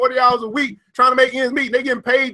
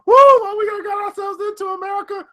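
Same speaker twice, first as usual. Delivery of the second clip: shouted laughing lament; 10 semitones higher than usual. Real, not acted. Vlogger crying out to America, high laughing shout. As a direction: holler at America in laughing despair. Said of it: "I'm laughing, but I'm crying".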